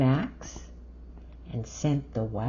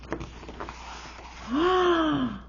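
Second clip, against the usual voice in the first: big delighted gasp; excited gasp; 10 semitones higher than usual. Acted. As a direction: gasp in wonder. Excited reading to child.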